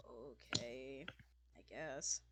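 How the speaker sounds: low, grudging okay; reluctant bartender. Acted. Bartender eyeing the customer's order, reluctant. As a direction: give in reluctantly, voice dropped low.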